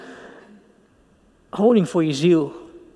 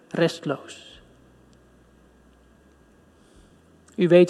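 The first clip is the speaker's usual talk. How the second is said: prayer; 2 semitones lower than usual. Acted. Preacher prays aloud.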